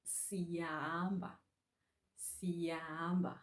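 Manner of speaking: siyahamba slowly drawn out; slow Xhosa demo. Shown as a demonstration. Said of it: "we are leaving".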